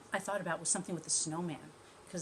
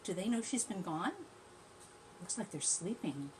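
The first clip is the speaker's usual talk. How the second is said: plain reading voice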